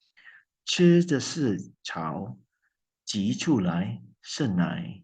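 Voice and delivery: slow, careful Mandarin quotation; quoting in Mandarin. Retold or imitated. Poet quotes Lu Xun to listeners, slow Mandarin. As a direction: spell out the Chinese line word by word. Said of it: "a quotation from the Chinese writer Lu Xun".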